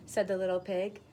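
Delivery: plain reading voice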